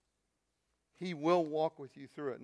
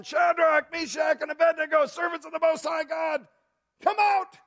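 high, loud shout calling into the fire; king shouting into the blazing furnace; 12 dB louder than usual. Acted. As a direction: bellow the names into the furnace. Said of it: "where he starts shouting".

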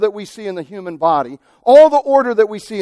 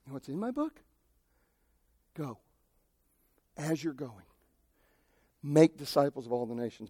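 much softer, lower and calm; quiet voice of God; 4 semitones lower, 14 dB quieter than usual. Retold or imitated. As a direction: answer gently as God. God answers calmly.

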